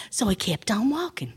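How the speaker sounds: usual speaking voice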